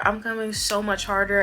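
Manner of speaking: ordinary delivery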